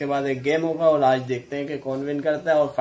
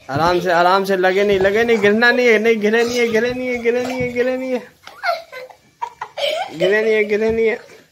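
urgent, repetitive calls, building in pitch; excited fight commentary; 7 semitones higher than usual. Real, not acted. Referee watching two fighters who haven't fallen yet, excitedly. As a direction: pitch climbing higher with each repeat.

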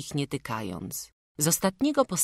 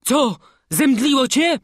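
calling out; 11 semitones higher, 10 dB louder than usual. A narrator's reading. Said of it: "zawołał stwórz".

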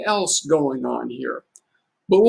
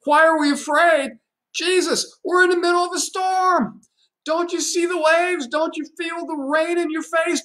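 higher, pitched-up mock yell over the storm; a man clinging to the boat, pitched high, mock yelling; yelling through the storm; 8 semitones higher than usual. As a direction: call out against the wind, half-acted. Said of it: "more likely yelling to Jesus through the storm".

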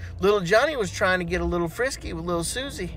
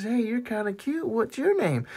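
flirty woman; 5 semitones higher, 7 dB quieter than usual. Acted.

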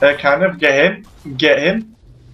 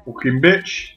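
usual speaking voice

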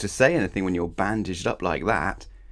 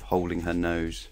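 ordinary delivery, nothing marked

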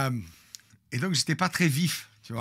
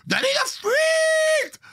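mock cop yell; 3 dB louder than usual. Police yelling at the fleeing scooter, hammed-up shout. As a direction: bark it like a TV cop. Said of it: "leur instinct Starkey Hutch".

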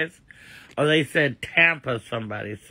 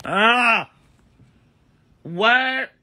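high, louder startled cry; shocked; 9 semitones higher, 4 dB louder than usual. Real, not acted. Reading the hurricane warning from chat, high startled alarm. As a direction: jolt up in alarm at the news.

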